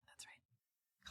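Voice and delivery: barely audible, voiceless whisper; whispered aside. Pretend. Host teasing Slade for the co-host, whispered aside. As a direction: whisper it under your breath.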